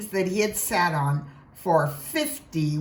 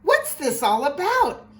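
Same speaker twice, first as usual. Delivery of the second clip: shouting; 7 semitones higher, 3 dB louder than usual. A narrator's reading. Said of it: "the people came shouting".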